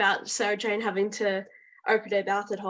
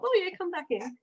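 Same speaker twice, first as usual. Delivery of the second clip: mock casual call; 9 semitones higher, 3 dB quieter than usual. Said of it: "then as soon as they stop".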